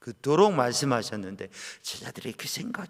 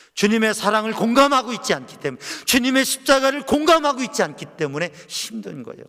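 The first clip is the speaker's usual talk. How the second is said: loud, high climactic exhortation; urging the flock at full force; fervent preaching; 10 semitones higher, 11 dB louder than usual. Acted. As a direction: pour it out as the sermon's peak.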